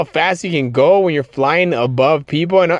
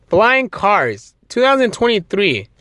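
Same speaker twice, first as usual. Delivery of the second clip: incredulous; 7 semitones higher than usual. Real, not acted. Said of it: "repeats the claim in disbelief".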